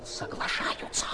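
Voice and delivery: breathy whisper, slow; whispered, almost voiceless; whispered report. Acted. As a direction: lean in and breathe the news. Orderly reporting quietly.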